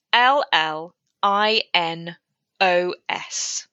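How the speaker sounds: slow, letter by letter; spelled out. Shown as a demonstration. Author spells a character's name for the host, slowly letter by letter. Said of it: "I shall spell the name for you".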